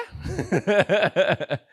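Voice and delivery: 惊讶后的大笑；一声响亮的笑；听到妈妈的话后大笑起来; surprised laugh. Real, not acted. Laughs at mother's words.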